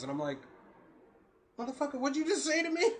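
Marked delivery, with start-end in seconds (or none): 1.6-2.9 quick, high-pitched challenge; retold indignant comeback; incredulous. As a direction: fire back in mock outrage. Retold shocked reply to a child.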